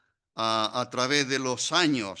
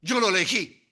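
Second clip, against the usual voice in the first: much higher, solemn voice of God; 12 semitones higher than usual. Acted. Preacher voicing God choosing Saulo, pitched high and solemn. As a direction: proclaim it as God claiming his own. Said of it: "Dios le está diciendo".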